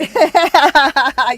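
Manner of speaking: loud laugh; laughter. Real, not acted. Host laughing about the chart number. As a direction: let it out.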